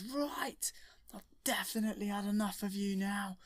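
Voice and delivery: high, fed-up hero voice; squeaky, exasperated put-on Luke; kid doing Luke. Luke telling the Emperor he's had enough, high and fed up.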